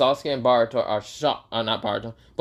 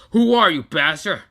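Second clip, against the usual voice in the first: high, loud furious challenge; angry villain shout; 5 semitones higher, 6 dB louder than usual. Acted. Momoshiki demanding who Venom is, shouting. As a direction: bark the question at the intruder.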